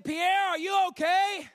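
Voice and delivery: high, loud, slow worried check; alarmed calling out. Retold or imitated. Evangelist checking on a shaking dying man, alarmed call. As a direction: call out to rouse him, acted alarm.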